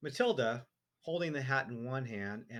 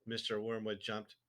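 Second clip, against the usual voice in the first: ordinary delivery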